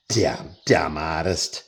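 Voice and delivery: slow, drawn-out mock jeer; lightly mocking slow jeer; mock self-insult. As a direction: drag it out like a heckler. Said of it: "they let me know how dumb I was".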